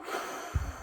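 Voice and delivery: short soft dragon exhale; dragon breath. Shown as a demonstration. Teacher showing kids a dragon breath out. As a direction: puff out like a dragon. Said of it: "Breathe out like a dragon".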